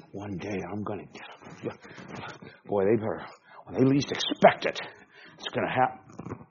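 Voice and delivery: grumbling to oneself about payback; stewing, muttered mock threat; mock grudge-holding. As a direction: act out a seething grudge, half under the breath. Acts out someone nursing a grudge.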